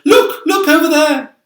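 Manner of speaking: loud high excited call; shout. Acted. Ladybird spotting the arch, loud high excited shout. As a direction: cry out pointing.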